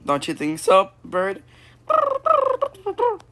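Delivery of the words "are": surprised. Acted. Character startled.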